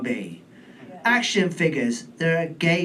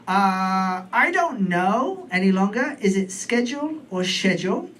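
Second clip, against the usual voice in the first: ordinary talk, nothing marked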